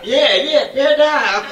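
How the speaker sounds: high laughing yeah yeah bear down. Retold or imitated. Laughing coach tells Rosetta to bear down. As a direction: cheer her on through laughter. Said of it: "I was like".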